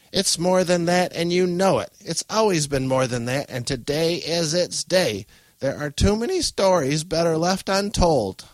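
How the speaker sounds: high, loud insistence; vehement old woman. Acted. Grandmother arguing with her daughter, vehement and loud. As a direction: insist with rising agitation.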